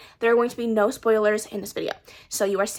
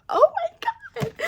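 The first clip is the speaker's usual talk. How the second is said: high, drawn-out squeal; slower than usual. Real, not acted. Reacting to the book.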